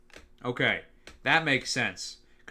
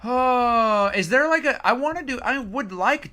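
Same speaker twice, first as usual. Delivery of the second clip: excited; 8 semitones higher, 6 dB louder than usual. Real, not acted. Sees the tier list.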